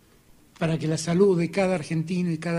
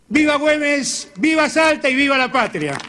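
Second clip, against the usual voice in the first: rallying cry; 9 semitones higher, 8 dB louder than usual. Acted. Closing cheers of speech.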